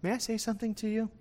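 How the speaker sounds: hushed, quick, deferential ask; softly polite. Retold or imitated. Paul softly asking the Roman tribune for permission to speak. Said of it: "I have to address him properly".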